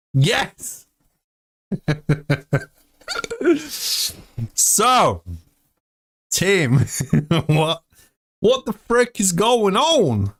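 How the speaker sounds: ordinary delivery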